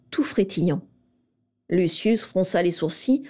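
ordinary delivery, nothing marked

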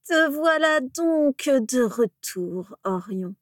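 slow, high, mocking drawl; mocking dark lord. A narrator's reading. Voldemort taunting the kneeling Orion, slow mocking voice. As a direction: savour every word with contempt.

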